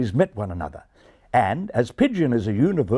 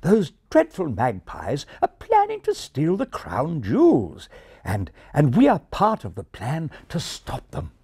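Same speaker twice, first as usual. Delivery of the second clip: pitched-up refined English pigeon; earnest, refined English voice; refined English accent; 6 semitones higher than usual. Acted. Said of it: "continued the English pigeon".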